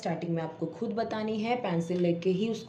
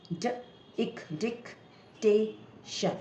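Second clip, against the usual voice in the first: slow, drawn-out syllables then "dictation" joined; split into syllables; 3 semitones higher, much slower than usual. Shown as a demonstration. Teacher showing a parent how to sound out dictation, slowly. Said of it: "जैसे vowel wise हम इसको break करते हैं".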